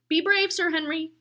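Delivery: calling out. Acted. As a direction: call it after him. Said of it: "called Mister Bruce".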